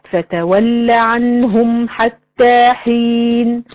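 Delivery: slow chanted verse on a level note; Quran recitation. Shown as a demonstration. Teacher quoting a verse as an example, recited slowly. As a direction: recite with long measured vowels. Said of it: "زي مثلًا ما أقولك إيه".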